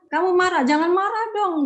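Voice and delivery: a hammed-up parent telling the child off; scolding parent. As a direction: play the parent who shuts down anger. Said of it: "gitu ya".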